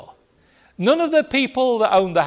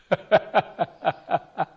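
amused laugh. Real, not acted. Laughing at own story.

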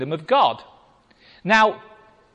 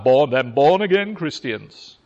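mocking quote; 4 semitones lower than usual. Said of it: "People talk about".

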